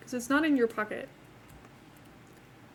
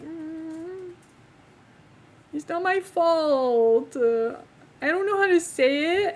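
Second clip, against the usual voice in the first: higher, drawn-out mock whine; mock whining; 4 semitones higher, 4 dB louder, much slower than usual. Embarrassed accented speaker defending herself, mock whining. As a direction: protest helplessly, hammed up.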